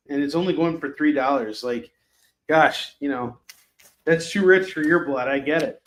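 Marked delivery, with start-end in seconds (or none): none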